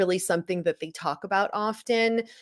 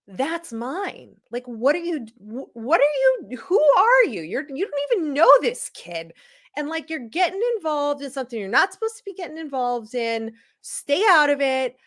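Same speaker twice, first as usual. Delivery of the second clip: higher, rising indignant rant; 7 semitones higher than usual. Retold or imitated. Retelling telling off the man who took her puck, indignant. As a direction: tell the stranger off, building. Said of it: "And it was like".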